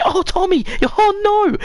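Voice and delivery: very high, put-on wail of dismay; high-pitched, hammed-up grief; mock lament. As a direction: cry it out in a squeaky mock voice. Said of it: "You say".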